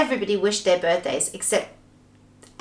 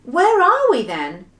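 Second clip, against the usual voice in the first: alarmed question, raised and slow; louder, higher, worried question; 7 semitones higher, 5 dB louder, slower than usual. Acted. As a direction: ask it with drawn-out alarm. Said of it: "said Beth, alarmed".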